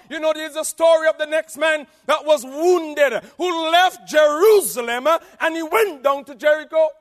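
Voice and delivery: loud, high sermon call; fervent preaching. Acted. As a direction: promise it to the crowd.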